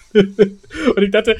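words pushed out through laughter, slow; laughing still as he starts. Real, not acted. Telling his cohost the story, still laughing.

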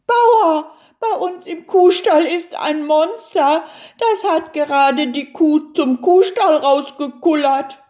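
high, urgent report; alarmed farmer's wife. A narrator's reading.

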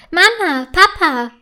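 calling out high with delight; joyful call. A narrator's reading. Fine finds her kite and calls her parents, joyful. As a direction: shout for the parents, overjoyed. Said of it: "ruft sie voller Freude".

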